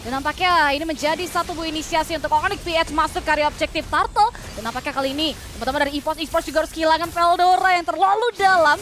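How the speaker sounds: loud, fast esports call; excited play-by-play. Real, not acted. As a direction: shout the teamfight as it happens. Live play-by-play of teamfight. (komentar heboh)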